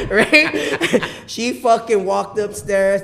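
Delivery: high, loud words through laughter, slow; laughing. Real, not acted. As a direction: keep laughing through the line. Laughing while telling wife story.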